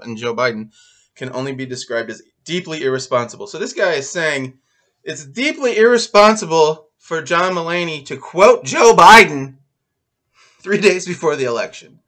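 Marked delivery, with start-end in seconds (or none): 5.0-12.0 loud, raised pitch, building to the name; incredulous. Retold or imitated. Host restating the writer's complaint, loudly incredulous. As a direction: hit the name hard in disbelief. Restates critic's claim in disbelief.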